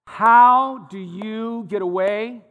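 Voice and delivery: loud, drawn-out, incredulous question; emphatic challenge. Real, not acted. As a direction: stretch each word out loudly to challenge them. Challenging question to Baptist ministers.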